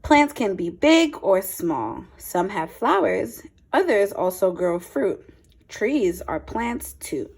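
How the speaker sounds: clear, lively picture-book read-aloud; a teacher's storytime voice, a touch brighter; reading to children. Acted. Reads picture book to class.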